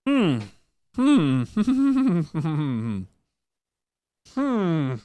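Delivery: high, loud "hmm" breaking into a humming laugh; hammed-up thinking noises; high pondering hum. Hams up pondering the problem.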